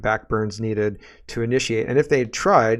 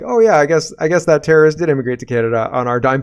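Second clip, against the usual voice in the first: loud mocking quote, pitched up at first; sarcastic mock admission; 4 semitones higher, 7 dB louder, faster than usual. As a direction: play their offhand admission with loud scorn. Sarcastically voicing the officials' admission.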